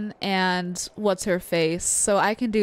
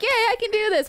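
much higher, cheering yourself on; high, confident mock cheer; 13 semitones higher than usual. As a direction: imitate singing along way up high. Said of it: "higher than I'm like".

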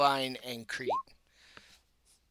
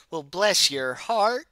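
slow, higher Southern drawl; pompous Southern accent; 4 semitones higher, 4 dB louder than usual. Acted. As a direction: draw it out with Southern condescension. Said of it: "Southern pompous".

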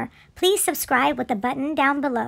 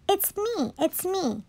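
softly voiced little duck; a hint of duck, softer and higher at first; duck character; 5 semitones higher, 6 dB quieter, slower than usual. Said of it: "says the duck".

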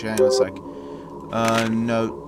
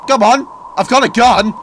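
loud taunt; 9 semitones higher, 8 dB louder than usual. Real, not acted. Taunting monster in horror game.

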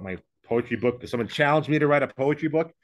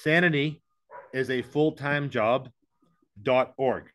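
slow, careful dictation of a web address; slowly spelled out; slower than usual. Shown as a demonstration. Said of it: "my website".

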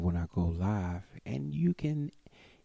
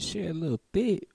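sly remark; 9 semitones higher, faster than usual. Real, not acted. Repeats with the same rise.

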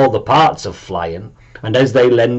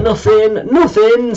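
nervously; 10 semitones higher than usual. A narrator's reading. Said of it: "said Zelen nervously".

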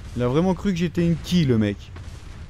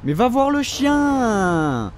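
high, very slow stretched shout; drawn-out exasperated yell; 7 semitones higher, much slower than usual. Real, not acted. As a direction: drag every word out in exasperation. Yelling at AI companion. (crier lentement, exaspéré, au compagnon)

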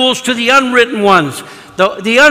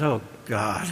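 very low, hushed aside; weary inward dread; 11 semitones lower, 13 dB quieter than usual. Retold or imitated. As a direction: sink into a private mock groan. Inward groan at woman's confession.

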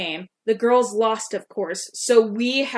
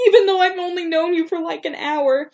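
laughing through tears; 8 semitones higher than usual. Real, not acted.

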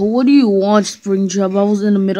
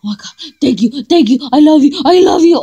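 rising, louder happy gush; gushing thanks; 5 semitones higher, 5 dB louder than usual. Acted. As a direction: thank them ecstatically.

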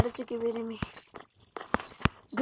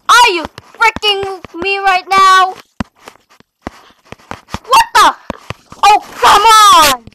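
raging yell; 4 semitones higher, 6 dB louder than usual. Real, not acted. Gamer raging at parkour.

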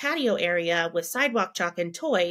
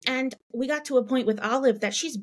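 normal talking voice

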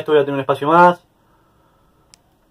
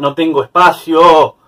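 slow, louder drawn-out complaint; frustrated; 2 semitones higher, 7 dB louder, much slower than usual. Real, not acted. Player finding the inventory full, drawn-out frustrated cry. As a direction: stretch it out in exasperation.

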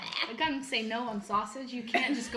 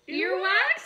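laughing; 7 semitones higher, 7 dB louder than usual. Real, not acted. Laughing disbelief at flavor.

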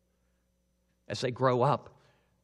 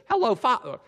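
much higher, louder youthful greeting; 11 semitones higher, 8 dB louder, faster than usual. Acted. Jacob greets his father in his natural voice, high and bright. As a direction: greet him in his own high young voice. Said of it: "instead of talking in your [name] voice".

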